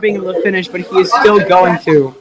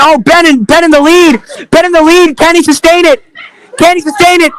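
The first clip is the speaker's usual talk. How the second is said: rising higher and louder as the race tightens; building excitement; 8 semitones higher, 4 dB louder than usual. Acted. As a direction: climb from eager to near-shouting. Close race, commentator building up.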